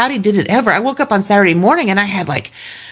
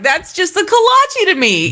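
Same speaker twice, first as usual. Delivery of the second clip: high mock shrug; 9 semitones higher than usual. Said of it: "I'm just like".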